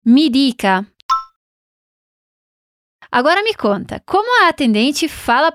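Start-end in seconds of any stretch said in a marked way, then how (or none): 0.1-0.9 "Mi dica" with a crisp Italian d in "dica"; Italian di sound. Shown as a demonstration. Teacher modelling "Mi dica" for the learner, clear and crisp. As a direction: say "Mi dica" cleanly, d then i. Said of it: "como ela fala "me diga"?".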